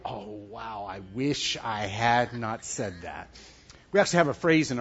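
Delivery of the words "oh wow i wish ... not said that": low, hushed regret, slowing down; quiet regret. Acted. Talker to self after words slip out, quietly regretful. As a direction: sink into a soft, rueful murmur.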